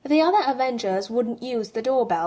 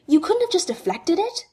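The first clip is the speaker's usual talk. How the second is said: demanding; 8 semitones higher, 4 dB louder than usual. A narrator's reading. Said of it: "Tony demanded".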